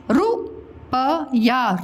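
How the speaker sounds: रुपया drawn out; very slow. Shown as a demonstration. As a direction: stretch रुपया for the class. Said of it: "teacher models word slowly".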